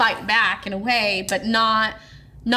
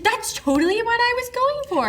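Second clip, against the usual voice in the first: very high, gushy put-on voice; mock fake-nice; 13 semitones higher, faster than usual. Mother-in-law answers a compliment on her table, mock fake-nice. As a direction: imitate a delighted hostess, pitched way up.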